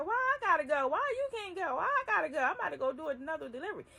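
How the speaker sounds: high, loud indignant protest; protesting loudly. Retold or imitated. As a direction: push back hard, why me. Refusing to go to the house.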